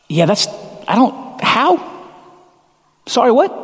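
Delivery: high, cracking how; stunned disbelief. Retold or imitated. Mary answering the angel, in high stunned disbelief. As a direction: squeak out confused disbelief. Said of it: "And she says".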